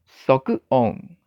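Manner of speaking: 缩音 said the Japanese way, carefully; Japanese term demo. Shown as a demonstration. Teacher showing learners the Japanese term 缩音. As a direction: model 缩音 crisply. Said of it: "日本叫做".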